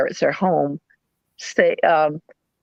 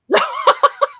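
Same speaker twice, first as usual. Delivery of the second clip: high squeal of a laugh; a burst of high giggling. Real, not acted. Laughing at own joke.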